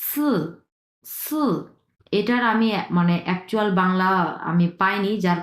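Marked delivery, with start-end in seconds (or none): 0.0-1.4 সু as long hissed "s" with buzzing vowel, high; Chinese "si" demo. Shown as a demonstration. Teacher shows how S-I sounds, isolated high-pitched demonstration. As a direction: start সু high, without rounding it into "oo". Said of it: "এটা হবে".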